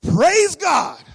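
very loud, high shout of praise; jubilant praise. Acted. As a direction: belt it out joyfully. Pastor rejoices at her bad news.

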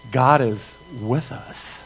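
normal talking voice

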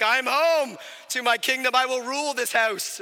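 loud, grand proclamation; hammed-up regal declaration; mock king of the house. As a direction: announce it like a monarch returning home. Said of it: "this is not how we talk at all".

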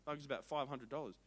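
ordinary talk

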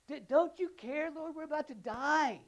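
slow, high and loud, begging in fear; panicked disciples. Acted. Disciples to Jesus in the storm, frantic pleading. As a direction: cry out like men about to drown.

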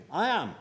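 "I am" proclaimed loud and high; emphatic I am. Shown as a demonstration. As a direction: declare "I am" with force. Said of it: "those two emphatic words".

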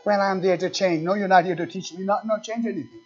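ordinary delivery

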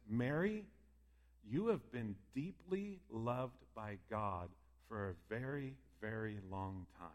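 soft and slow, fading to a hush; tender. Acted. Angel tenderly reassuring Mary, soft and slow. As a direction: gently reassure her she is loved. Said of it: "he would say something like this".

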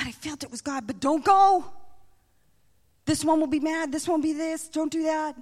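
high-pitched, urgent voice of doubt; anxious warnings. Acted. Enemy's anxious warnings, acted out.

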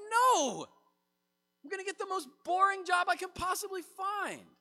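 high, loud hammed-up no; mock panicked refusal. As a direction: yell the refusal as a horrified younger self. Speaker refuses God's plan.

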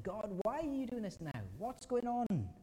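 high, bewildered cry to God; the psalmist crying out, high and pleading; psalmist's plea. Acted. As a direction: plead upward, baffled. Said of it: "the psalmist is saying".

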